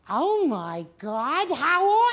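mother rushing out to greet guests, slow then shrill; Edith Bunker. Retold or imitated. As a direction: stretch the oh then squeal the greeting. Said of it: "She's like Edith Bunker".

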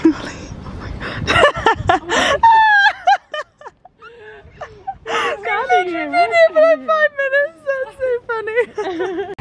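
high, slow squeals through laughter; half laughing, half crying with joy; laughing tearfully. Real, not acted. Greeting her dog after time apart, high laughing squeals.

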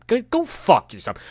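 high-pitched exasperated snap; angry dismissal. Real, not acted. Speaker to an imagined blamer, angry dismissal. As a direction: tell them off, pitch jumping up.

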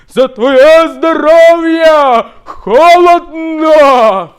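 high, loud, slow call across the water. Retold or imitated. Friend in the barrel shouts a toast, loud drawn-out call. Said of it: "гукає".

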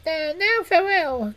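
very high squeaky rat voice; eager, relieved little rodent. Acted. Rat speaks.

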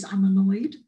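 a clipped confession of irritation; bluntly annoyed. Retold or imitated. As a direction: drop the politeness and say it straight. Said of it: "what I really mean is".

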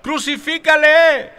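very high, loud and slow mob scream; loud drawn-out crowd scream. A narrator's reading. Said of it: "volvieron a dar voces".

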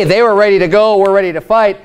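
usual speaking voice

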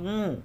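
"উঁ" sounded lightly through the nose; light nasal sound. Shown as a demonstration. Teacher showing how the letter sounds, "উঁ" lightly nasalised. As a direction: let "উঁ" hum gently in the nose. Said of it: "হালকা নাকের স্বর দিয়ে".

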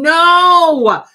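a loud, high, cutting "No!"; sudden loud refusal; emphatic no. Real, not acted. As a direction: shout it down hard. Rejecting the self-blame.

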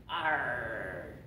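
long drawn-out pirate "arr". Shown as a demonstration. Teacher asking the class how pirates sound, pirate growl. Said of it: "what does a pirate sound like".

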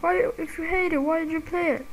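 high, mocking question; mocking viewer. Viewer asking why he plays, a high mock voice. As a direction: squeak it like a smug critic. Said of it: "Be like".

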